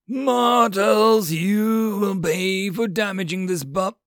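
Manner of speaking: slow, high, booming proclamation; yelled threat. A narrator's reading. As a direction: thunder it down at the mortals. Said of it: "Dante yelled".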